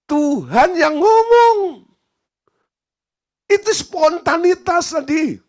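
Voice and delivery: emphatic proclamation. Real, not acted. Pastor revealing to the director that God spoke, slow and emphatic. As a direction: preach the punchline, every word weighted.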